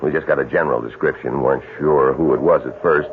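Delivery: normal talking voice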